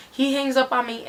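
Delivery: ordinary delivery, nothing marked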